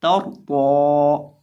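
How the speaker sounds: कौ stretched very long and loud; drawn-out कौ in recitation; long drawn vowel. Shown as a demonstration. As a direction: hold the vowel of कौ out for a long time. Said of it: "खड़ी ज़बर कौ".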